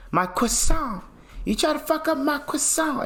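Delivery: put-on high-pitched, botched French accent.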